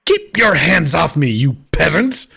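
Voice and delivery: haughty angry snap; disdainful lord pulling away; angry noble. Acted. Nobleman shakes off hand.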